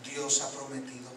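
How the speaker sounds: soft, low preaching with long pauses; calm closing exhortation. Real, not acted. Preacher closing his sermon to the church, hushed reassurance.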